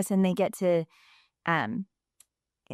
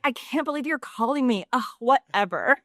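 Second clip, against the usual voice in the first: put-on higher-pitched, exasperated teen sass; sassy teenage girl; 5 semitones higher than usual. Voice agent griping at callers, as a sassy teenage girl. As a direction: imitate a sassy teenager who can't be bothered. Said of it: "like, sassy teenage girl".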